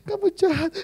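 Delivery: high, far mock wail of hurt; imitating tearful maid. Pastor imitating his maid crying on the phone, high and wailing. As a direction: squeak it out like a hurt girlfriend.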